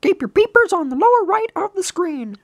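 high-pitched cartoon instructor; helpful game guide. Guide pointing hero to the screen, high voice. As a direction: instruct in a squeaky character voice.

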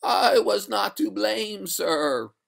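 high, slow, drawn-out spectral voice; eerie high spectre; water ghost. Acted. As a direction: protest in a stretched ghostly wail. The ghost answers the master.

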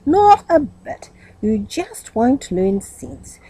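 slow, deliberate telling off; emphatic scold. A narrator's reading. Aunt lecturing her worn-out nephew, slow emphatic. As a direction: lay each word down slowly.

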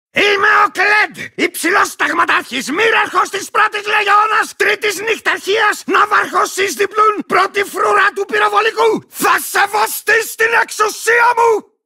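high, shouted roll-call of titles; pompous proclamation. Acted. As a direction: announce your ranks and demand obedience. Proclaims his own string of titles. (πομπώδης, στεντόρεια αναγγελία)

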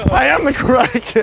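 high, forceful karate-hero announcement; play-fight battle cry. Pretend. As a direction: proclaim it like a mock champion. Mock fight war cry.

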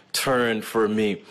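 usual speaking voice

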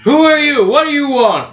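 high, loud challenge from a window; calling from upstairs. Acted. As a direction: shout down at an unwelcome caller. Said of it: "You hear from a window upstairs".

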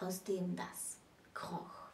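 slow, hushed recitation fading to a whisper; grave, slow quote that ends in a whisper; solemn quoted verse. Shown as a demonstration. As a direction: let the Brecht line sink into a dark whisper. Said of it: "wie Bert Brecht geschrieben hat".

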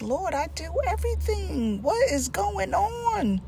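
high, soft pleading cry to the Lord; Job pleading. Retold or imitated. Job crying out to the Lord, pleading high and soft. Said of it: "He's like".

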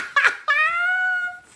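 loud shrieking burst of laughter; high squealing laugh. Real, not acted. Laughing at her husband's teasing, a loud high squeal.

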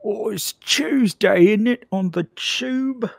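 cartoon Cockney accent; bad Cockney. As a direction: squeak it in a terrible Cockney. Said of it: "how terrible can you do this voice".

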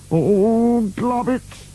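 high-pitched drawn-out dismay; dismayed mutter. A narrator's reading. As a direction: lament it in a high character voice. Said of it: "muttered Burke".

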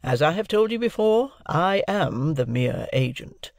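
high, emphatic, formal declaration; authoritative lawyer. A narrator's reading. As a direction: set out the terms clause by clause. Lawyer figure sets out the terms.

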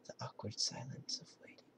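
breathy whisper to himself. Real, not acted. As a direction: murmur it under your breath. Waiting in silence, whispering.

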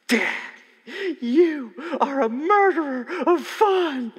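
put-on high, loud, slow whiny child's protest; mock an outraged kid wailing at her dad; sulky daughter. Father imitating his angry daughter, high and loud whining. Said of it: "That's not literally what they sound like".

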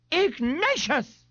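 high, drawn-out shriek; shrieked name. A narrator's reading. Mother protesting to her son Ignatius, shrieking.

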